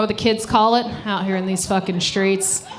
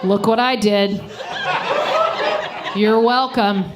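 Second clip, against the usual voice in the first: imitating ex; slower than usual. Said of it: "he'd just be like".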